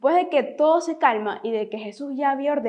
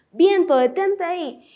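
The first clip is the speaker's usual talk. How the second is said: más agudo, más fuerte y lento, como quien manda; orden firme de Jesús; 5 semitones higher, 5 dB louder than usual. Acted. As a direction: ordena al viento con autoridad. (Jesús manda al viento que se detenga, con voz fuerte y pausada)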